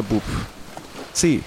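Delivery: ordinary talk, nothing marked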